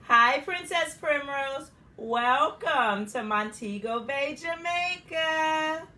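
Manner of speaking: slow, loud sing-song greeting; boys' welcome. Acted. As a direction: welcome her together, drawn out. Boys greeting in unison.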